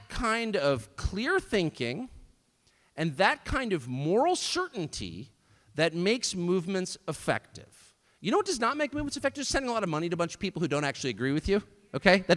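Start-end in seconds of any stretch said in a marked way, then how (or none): none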